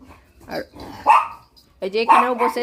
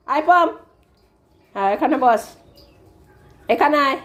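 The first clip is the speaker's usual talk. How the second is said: normal talking voice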